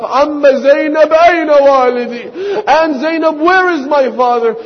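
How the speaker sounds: put-on pleading child voice; little girl's plea. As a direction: voice a frightened small girl asking for her father. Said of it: "this four-year-old girl wakes up".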